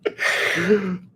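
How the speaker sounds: high-pitched real laugh; burst of laughter. Real, not acted. Bursts out laughing at chat message.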